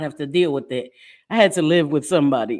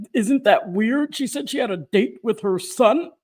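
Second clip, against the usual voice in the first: judgy internet commenters; 5 semitones higher than usual. Retold or imitated. Mocking people online who find a son date weird. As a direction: play the nosy commenter clutching pearls. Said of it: "they're like".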